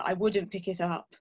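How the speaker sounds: ordinary delivery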